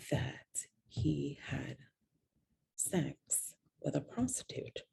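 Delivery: soft, slow whisper; confiding whisper. Real, not acted. Confiding to viewers about his cheating, in a slow whisper. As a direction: drop to a whisper as if it's shameful.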